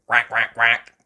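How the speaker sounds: loud quick duck quacks. Acted. Missus Duck greeting the family, loud quacking. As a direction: quack it out sharply.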